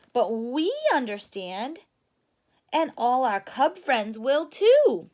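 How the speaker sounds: put-on bright young Sister cub. Sister's plea.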